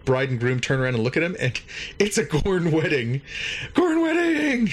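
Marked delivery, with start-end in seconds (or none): 3.8-4.7 very high, hammed-up squeal; mock shriek. Teller reveals the absurd wedding scene, high mock shriek.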